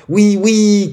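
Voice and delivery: high, loud, eager yes; children crying out. A narrator's reading. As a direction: shout it high like excited kids. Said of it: "crièrent-ils". (cris d'enfants enthousiastes, aigus)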